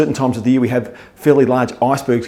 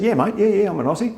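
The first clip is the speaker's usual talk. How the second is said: higher, hammed-up Australian accent; exaggerated Aussie accent; 8 semitones higher than usual. Aussie playing up to Americans, exaggerated Australian accent. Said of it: "Let's just bung it on a bit".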